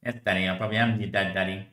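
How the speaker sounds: soft, low put-on kid voice; hushed, lightly voiced child; child's voice to daddy. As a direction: quietly play the little one answering. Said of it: "why you're doing that voice".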